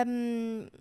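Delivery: usual speaking voice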